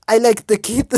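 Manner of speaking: put-on loud try at a German accent, "like" stressed; attempted German accent. Kurt speaking of the boy, failed German accent. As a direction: bark "I like the kid" in a German accent. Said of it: "I hate doing his voice".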